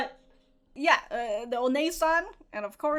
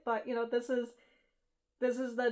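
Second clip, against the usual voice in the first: ordinary talk, nothing marked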